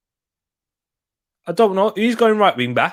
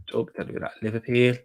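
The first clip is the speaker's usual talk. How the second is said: whispering; 8 semitones lower, 6 dB quieter, faster than usual. Real, not acted. Dreading the Liverpool lineup.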